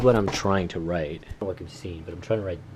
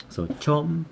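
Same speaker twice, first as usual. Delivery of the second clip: normal talking voice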